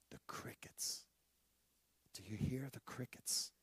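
unvoiced breathy whisper; whispered pointing out the crickets. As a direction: whisper it as if not to scare them.

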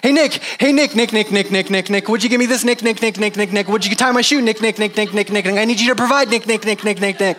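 high pestering repeated calls; hammed-up nagging of a friend, high and insistent; mock nagging. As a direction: badger a friend like a whiny kid. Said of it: "If I'm talking with Nick here".

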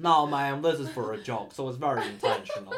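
put-on Alabama accent. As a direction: protest in a mock Southern Alabama accent. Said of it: "I can't stop with the Bama".

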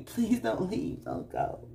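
hammed-up soft, slow begging; mock pleading. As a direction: imitate a clingy lover begging softly. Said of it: "Why am I talking like this".

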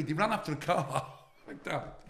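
ordinary talk, nothing marked

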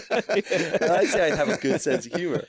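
says it through a real laugh, slowly. Real, not acted. Guest boasting about his humour, laughing. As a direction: laugh out the boast, high and loud.

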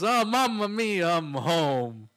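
high, slow, loud hammed-up Italian accent; over-the-top Italian accent, sung out; mock Italian accent. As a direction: ham up a cartoon Italian plumber. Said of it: "he goes".

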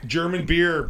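ordinary talk

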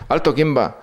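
grito agudo de centinela asustado; soldado nervioso. Retold or imitated. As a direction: imitando a un recluta que tiembla. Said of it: "me apunta un tío temblando y dice". (el recluta apunta al alférez, asustado y agudo)

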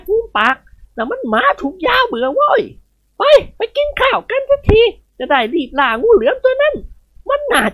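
put-on high, snappish old lady; irritated retort. Khun Ying Wad irritated with Nikorn, high and sharp. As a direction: snap back impatiently. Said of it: "คุณหญิงว่าชักฉิว".

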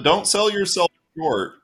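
usual speaking voice

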